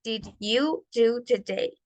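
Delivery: slow, evenly spaced words; repeat-after-me model. Shown as a demonstration. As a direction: model it slowly for the class to join in. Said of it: "pueden repetirlo junto conmigo".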